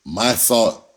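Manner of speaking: ordinary talk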